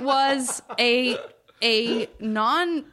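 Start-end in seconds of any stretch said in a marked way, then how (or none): none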